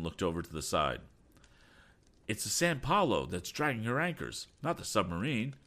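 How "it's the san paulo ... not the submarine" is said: raised-pitch urgent correction; shout. A narrator's reading. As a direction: call it out over the storm. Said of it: "he shouted".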